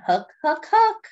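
put-on playful rising chant for kids; climbing refrain. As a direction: build the chant up to a high peak. Reading sound words to children.

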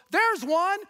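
high, loud eager call. Retold or imitated. Preacher's friend Doug spotting a boy in the park, calling out eagerly. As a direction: spot the target and call it out high. Doug spots a kid to evangelize.

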